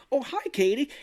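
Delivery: high, put-on phone cheer; fake-cheery greeting. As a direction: greet as if nothing is wrong.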